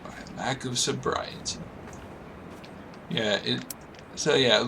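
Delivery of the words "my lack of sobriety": whispering. Real, not acted. Echoing the game's line.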